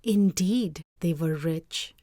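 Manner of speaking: usual speaking voice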